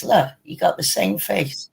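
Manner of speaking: usual speaking voice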